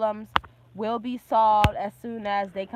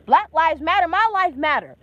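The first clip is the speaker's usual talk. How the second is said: defiant shout; 4 semitones higher, 7 dB louder than usual. Real, not acted. Heated rant, shouting slogan back.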